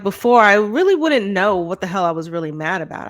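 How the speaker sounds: ordinary talk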